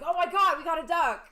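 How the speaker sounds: mock alarm. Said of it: "make it like it's like".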